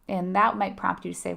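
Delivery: normal talking voice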